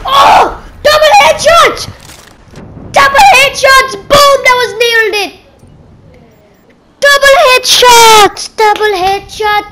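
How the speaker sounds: high, loud burst of triumph; real over-the-moon gamer celebration; ecstatic shouting. Real, not acted. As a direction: yell it out, thrilled by the kill. Celebrates two headshots at once.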